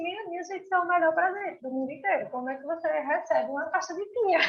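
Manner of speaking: high, fast, delighted exclamation; amazed gush. Retold or imitated. Guest quoting her own delight at the gift, high fast gush. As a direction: gush over the gift in disbelief.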